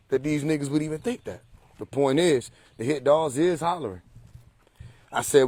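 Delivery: ordinary talk, nothing marked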